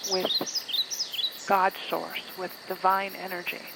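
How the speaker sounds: phrases spaced out by long, unhurried pauses; guided meditation. Acted. Guide asking listeners to become aware of their body, guided meditation voice. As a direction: lead them inward, letting each phrase settle.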